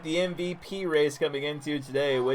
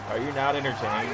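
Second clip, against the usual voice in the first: home run call; faster than usual. Shown as a demonstration. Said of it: "Let's give him the home run call as well".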